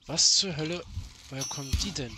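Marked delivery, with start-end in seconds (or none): 0.1-0.8 loud startled outburst; surprised shout. Real, not acted. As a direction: shout it in sudden shock. Plötzlich Gegner aufgetaucht. (lauter, erschrockener Ausruf)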